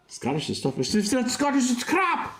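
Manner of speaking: put-on hammy Scottish-accented rant; mock Scottish accent. Streamer quoting an old SNL skit in mock Scottish. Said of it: "the old Dana Carvey skit from S N L".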